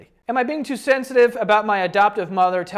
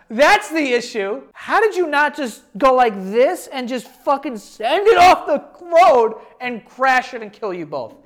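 outraged; 6 semitones higher, 6 dB louder than usual. Real, not acted. Outraged reaction to the post.

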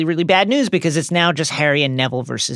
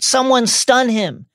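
high, loud, slow command; shouted order; 10 semitones higher, 4 dB louder, slower than usual. A narrator's reading. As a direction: shout the order over the struggle. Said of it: "the Death Eater shouted".

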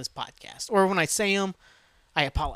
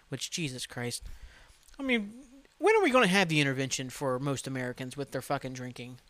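usual speaking voice

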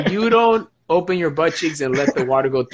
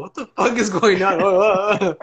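mock baffled; 6 semitones higher, 5 dB louder, slower than usual. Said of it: "people are like".

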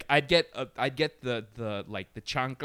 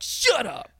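sibling snap; 9 semitones higher, 6 dB louder than usual. Retold or imitated. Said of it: "I'll just be like".

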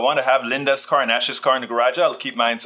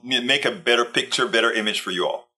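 ordinary talk, nothing marked

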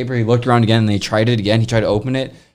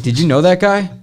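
higher, louder startled question; retold bewildered question; 7 semitones higher, 5 dB louder than usual. AT&T guy asking about the intruder, retold bewilderment. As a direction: act out the installer's disbelief, raised pitch.

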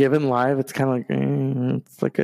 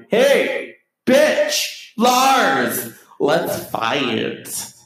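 mock James taunt; 6 semitones higher, much slower than usual. James heckling Lars from the background, taunting. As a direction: goad Lars into a fight. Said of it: "James is in the background like".

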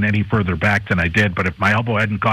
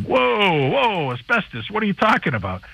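mock alarm, pitched much higher in a put-on voice; startled front desk guy; 9 semitones higher than usual. Host imitating the hotel clerk hearing about asbestos, much higher and startled. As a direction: jump up high on the whoas like a caught-out clerk. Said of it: "And the front desk guy's like".

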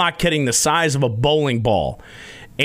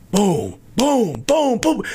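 rising boom sound effects, getting higher; mock booming; 9 semitones higher, much slower than usual. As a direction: thump it out like a ball hitting the leg. Imitating ball thumping his leg.

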